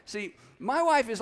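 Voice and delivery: normal talking voice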